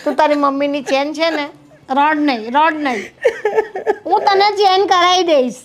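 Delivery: low, gentle hush; soothing mother. Acted. Mother soothes crying daughter.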